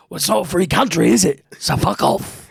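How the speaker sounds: high, loud put-on gangster snarl; quoting Snatch in a mock tough-guy voice; movie gangster line. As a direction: spit it out like a hard-man villain. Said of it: "he says the line".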